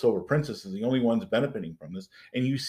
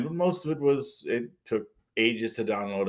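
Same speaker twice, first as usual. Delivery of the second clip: usual speaking voice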